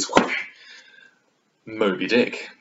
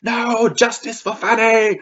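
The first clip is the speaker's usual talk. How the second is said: high, loud, drawn-out rallying cry; mock protest cry; 14 semitones higher, 7 dB louder than usual. Reader championing Fanny against her suitor, mock outraged cry. Acted outcry on heroine's behalf.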